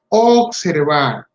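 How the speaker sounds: Observar said very slowly and firmly, each syllable drawn out; stressed slow word. Shown as a demonstration. Lecturer to parents, stressing Observar slowly. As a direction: lean on Observar, slow and deliberate. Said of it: "Hay que observar".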